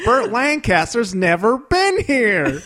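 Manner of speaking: high, slow, thrilled revelation; excited Huell Hauser. Retold or imitated. Host excited about the painting, slow and high. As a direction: savor the news with delight. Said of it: "He gets excited about it".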